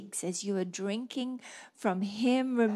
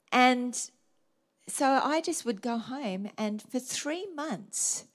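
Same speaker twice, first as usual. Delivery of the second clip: ordinary talk, nothing marked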